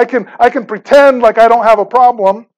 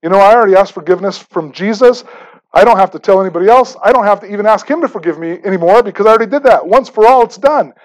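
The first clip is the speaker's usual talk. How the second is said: rattled off quickly, self-assured; a quick, smug inner monologue; fast inner thought; faster than usual. Retold or imitated. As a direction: rush through the excuse as if settled. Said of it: "and I'm thinking".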